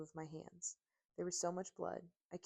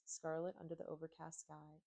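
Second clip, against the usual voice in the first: ordinary delivery, nothing marked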